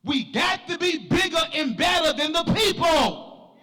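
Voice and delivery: high, driving church sermon delivery; full-force preaching. Real, not acted. Preacher exhorting the church to be different, full-force preaching. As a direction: preach it hard, stretching the phrase endings.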